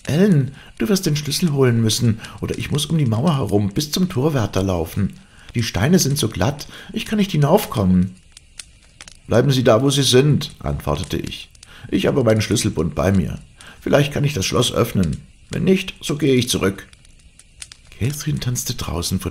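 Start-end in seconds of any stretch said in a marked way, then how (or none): 0.0-8.1 put-on high, girlish call over a wall; high-pitched exclamation. A narrator's reading. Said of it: "hörte ich Cathy lachen und ausrufen". 9.3-10.5 high, loud call through the gate; raised-voice instruction. A narrator's reading. Nurse calls through locked gate. 11.9-16.9 raised pitch, busy reassurance; higher, practical call; calling through a gate. A narrator's reading. Nurse calls through locked gate.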